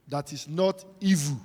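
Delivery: ordinary talk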